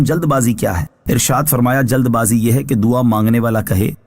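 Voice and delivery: ordinary talk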